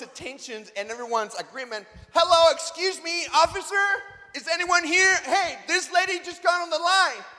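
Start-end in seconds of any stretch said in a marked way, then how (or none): 2.1-7.2 loud, high public appeal; indignant calling out. Retold or imitated. Man calling an officer about a line-cutter, indignant. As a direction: call for attention, projecting over everyone.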